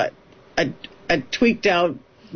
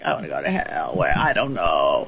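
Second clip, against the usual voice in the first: much lower, distant, demonic growl of a spirit; possessed voice. Retold or imitated. A medium voicing the spirit answering the crew, deep demonic growl. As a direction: let something dark speak through you, low and forceful.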